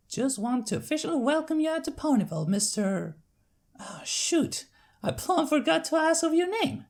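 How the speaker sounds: friendly big-sister country voice; Applejack's country accent. Acted. Applejack sending her sister to the farm, Southern accent.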